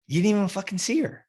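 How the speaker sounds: retold scolding. Scolding client in retold talk.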